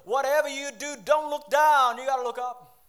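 high, very loud pulpit shout; shouted preaching. Real, not acted. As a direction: shout the warning to the congregation. Preacher drives home main point.

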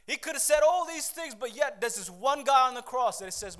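loud, high, driving sermon voice; full-force preaching. Acted. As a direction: preach it with everything you have.

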